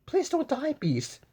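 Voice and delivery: high pleading cry. A narrator's reading. Beauty begging the dying Beast, high pleading cry. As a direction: beg him not to die. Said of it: "She cried".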